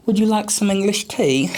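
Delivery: a hammed-up posh English accent, pitched well up. Shown as a demonstration. Asked by the interviewer to try it, a playful mock English accent. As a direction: put on your best English accent for the tea line. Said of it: "Can you do an English accent?".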